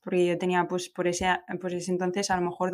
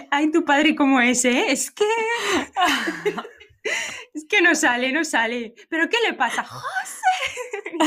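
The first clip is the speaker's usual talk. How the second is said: high laughing mock-exasperated mother; mock the mother losing patience with him; exasperated mom; 7 semitones higher than usual. Mother complaining to daughter that José won't come out, high and laughing.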